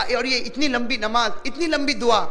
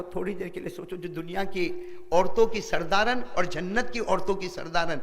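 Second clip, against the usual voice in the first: ordinary delivery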